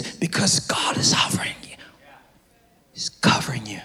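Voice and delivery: hushed unvoiced whisper. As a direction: drop to a whisper as if sharing a secret. Sudden hush mid-sermon.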